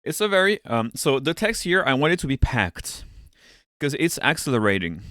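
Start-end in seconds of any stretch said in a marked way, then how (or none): none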